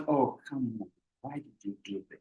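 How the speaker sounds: soft and sinking, dropping to near silence; falling tone demo. Shown as a demonstration. Lecturer demonstrating to class how tone comes down, soft. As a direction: let the pitch fall away. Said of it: "So the tone will come down".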